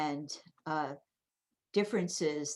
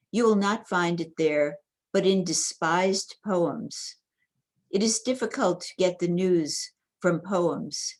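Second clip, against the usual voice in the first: loud, measured poem recital; poetry reading; 7 dB louder than usual. Real, not acted. Department chair reading a poem to the gathering, loud, deliberate recital. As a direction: declaim the verse line by line, projecting strongly.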